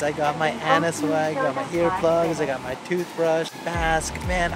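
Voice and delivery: usual speaking voice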